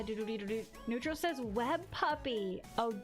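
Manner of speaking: normal talking voice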